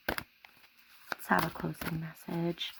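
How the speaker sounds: hushed, slow summoning voice; slow soft invocation. Acted. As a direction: draw it out quietly, like an invocation. Asking spirit for message.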